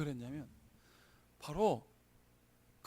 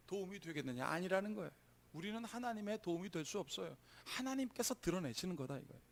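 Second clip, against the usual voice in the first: normal talking voice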